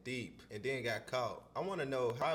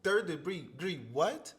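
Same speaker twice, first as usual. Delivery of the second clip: higher and louder, rising in shock; stunned disbelief; 6 semitones higher, 5 dB louder, slower than usual. Real, not acted. Viewer replaying the charge, disbelieving higher rising question. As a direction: repeat it as if you can't believe your ears. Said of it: "that one caught me off guard".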